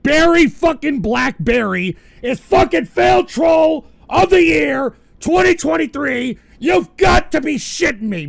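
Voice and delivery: loud, high ranting yell; angry shout. Real, not acted. Streamer raging about the fail troll winner. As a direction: bellow it out in outrage.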